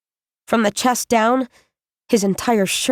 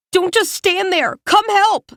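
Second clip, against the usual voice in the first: higher urgent call for help; urgent plea; 9 semitones higher than usual. A narrator's reading. Spingate urging Em to help, higher and urgent. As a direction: push her to move now.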